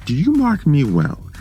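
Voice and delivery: high, rising manic pitch; frantic insistence. A narrator's reading. Madman insists to the listener, voice climbing in frenzy.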